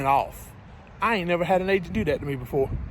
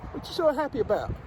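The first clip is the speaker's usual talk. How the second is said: pet talk; 5 semitones higher, 4 dB quieter than usual. Real, not acted. Trucker asking his dog why it's happy, higher pet voice.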